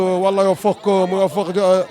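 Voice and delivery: ordinary delivery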